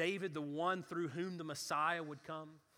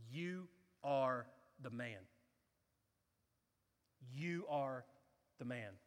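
solemn accusation; 3 semitones lower, slower than usual. Acted. Prophet points at David.

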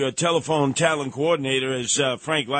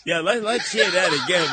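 ordinary talk

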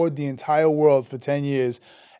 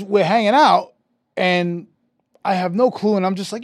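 ordinary talk, nothing marked

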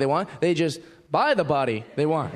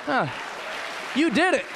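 teasing gym buddy; 4 semitones higher than usual. Retold or imitated. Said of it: "be like".

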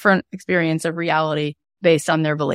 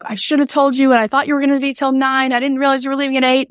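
put-on higher, rushed voice of a panicking eleven-year-old; anxious child, fast; imitating upset daughter; 8 semitones higher, faster than usual. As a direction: play the frantic kid, quick. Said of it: "now she was like".

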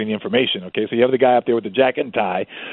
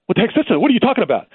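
upset protest; 10 semitones higher, 3 dB louder, faster than usual. Retold or imitated. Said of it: "got really upset".

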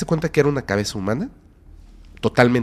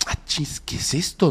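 startled whisper; 4 semitones higher, 4 dB quieter, slower than usual. Retold or imitated. Startled retelling in the dark.

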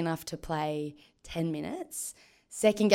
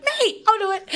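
eager volunteering; 8 dB louder than usual. Retold or imitated.

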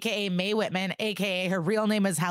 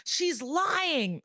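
mock scream; 9 semitones higher than usual. Podcaster retells a defendant's outburst in court, mock scream. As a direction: imitate screaming in a raised pitch. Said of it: "Cole starts screaming".

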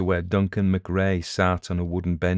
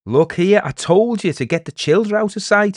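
high, loud village scold; 12 semitones higher, 8 dB louder than usual. Acted. Elder telling villagers off about the children, scolding. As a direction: tell them off at full voice.

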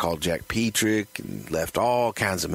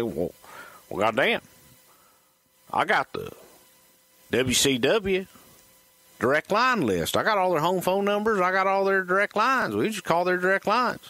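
Dusty bragging to friends in the car, high and fast; Dusty Rhodes impression; 11 semitones higher, faster than usual. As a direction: brag about the phone list like Dusty. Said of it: "Bruce's impressions".

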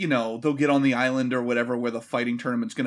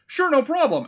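high-pitched mock-obliging reply; fake agreeable; 11 semitones higher, 4 dB louder than usual. Liu Kang answering Johnny about his bags, fake agreeable. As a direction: chirp a falsely willing yes.

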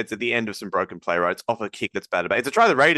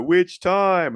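higher-pitched mock fan chant; gloating rival fans; 8 semitones higher, 4 dB louder than usual. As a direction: crow it like a smug fan. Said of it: "And they'll go".

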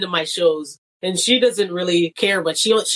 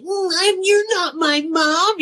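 sulky stepkid; 10 semitones higher, slower than usual. Retold or imitated. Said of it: "you get the thing like".

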